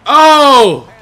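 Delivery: high, loud exclamation; real startled shout. Real, not acted. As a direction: shout out in surprise. Reacting to a play.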